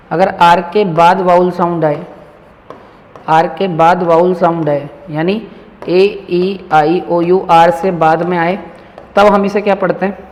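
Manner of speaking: ordinary talk, nothing marked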